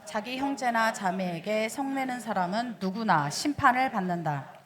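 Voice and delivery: slow, measured congregational reading; scripture reading. Acted. Pastor leading the congregation through a Bible verse, measured reading.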